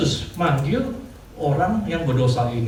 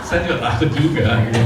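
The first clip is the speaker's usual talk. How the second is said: fast, louder, through laughter; laughing confession; 3 semitones lower, 5 dB louder, faster than usual. Real, not acted. Pastor confessing to the church he is scared too, laughing. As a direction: admit it while still laughing.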